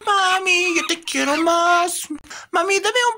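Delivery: put-on high-pitched child talking to mommy; little child. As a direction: plead sweetly in a high little-kid voice. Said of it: "Soy un niño".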